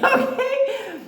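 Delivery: laughing tag question. Real, not acted. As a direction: laugh it off to the audience. Laughing aside.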